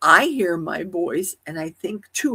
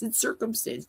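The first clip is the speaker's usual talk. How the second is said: normal talking voice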